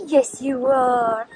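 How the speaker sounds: drawn-out gentle reassurance; slow soft coo. Real, not acted. Cooing to her dog.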